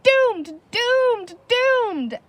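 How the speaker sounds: high, loud repeated shout of doom. A narrator's reading. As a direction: yell it at the top of your voice. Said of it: "shouting ... at the top of his voice".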